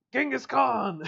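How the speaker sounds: high loud delighted exclamation; high, loud, startled cry of recognition; surprised shout. Real, not acted.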